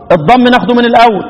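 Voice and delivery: ordinary talk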